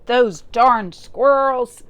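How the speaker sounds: put-on loud, slow, cranky old man shout; angry old man yell. Old man yelling at the squirrels, angry and slow. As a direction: shake your fist and yell it. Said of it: "he shook his old man fist and yelled".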